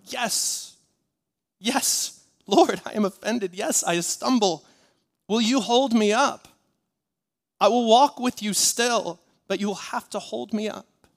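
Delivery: high, loud preacher's cry; crying out to God. Acted. Believer answering the Lord's question, crying out. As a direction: cry it out, easing off at the end. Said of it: "you must cry out".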